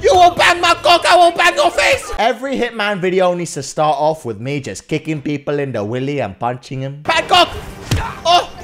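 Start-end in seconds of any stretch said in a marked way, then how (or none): 0.0-2.1 higher, louder hammed-up yell; mock battle yell. Gamer yelling at the guards he beats up. 7.0-8.5 mock battle cry. Gamer yelling the city name mid-punch. Shouting while hitting.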